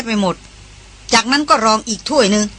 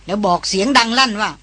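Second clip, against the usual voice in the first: usual speaking voice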